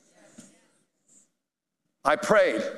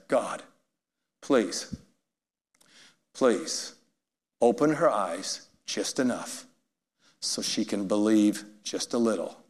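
quiet prayer; 6 semitones lower, 4 dB quieter, slower than usual. Retold or imitated. Pastor's prayer for the woman.

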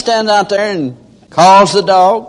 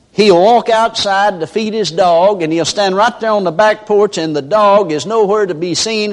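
usual speaking voice

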